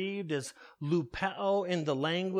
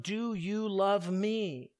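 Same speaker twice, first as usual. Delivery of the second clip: drawn out, slower and heavier; slow, searching question; slower than usual. Acted. Jesus pressing Peter a third time, slow and searching. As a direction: let the question hang, slowly.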